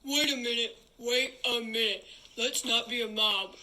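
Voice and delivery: loud, incisive command to halt the crowd. Acted. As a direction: stop the mob with a loud, cutting order. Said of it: "But Steve's voice, loud, incisive, and commanding".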